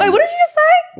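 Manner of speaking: high, loud teasing disbelief; mock surprise. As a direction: pretend you can't believe your ears.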